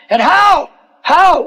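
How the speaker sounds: high, anxious cry of how; panicked disciple asking how; alarmed Peter. Acted. Peter panics about taxes.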